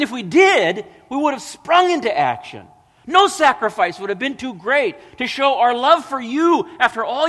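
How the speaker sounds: high, loud, incredulous self-defence; indignant protest. Acted. The condemned answering the Lord in self-justification, high indignant protest. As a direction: plead innocence with rising, overeager insistence.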